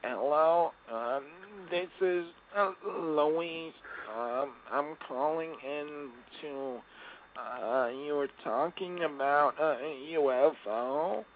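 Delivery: put-on high, slow little old lady caller. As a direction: play a frail old lady phoning in. Host voices old lady caller.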